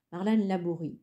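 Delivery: ordinary delivery, nothing marked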